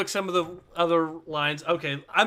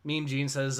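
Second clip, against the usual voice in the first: normal talking voice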